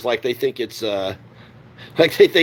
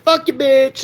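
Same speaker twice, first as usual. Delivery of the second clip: higher, defiant snap back; defiant retort; 11 semitones higher, slower than usual. Retold or imitated. Bullied kid answering his bullies, high defiant retort. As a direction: spit it at the bully. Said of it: "I'd be like".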